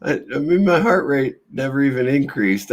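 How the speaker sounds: high-pitched, breaking into a laugh; amused, voice lifted by laughter; laughing while talking. Real, not acted. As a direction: insist through leftover giggles. Still laughing while telling story.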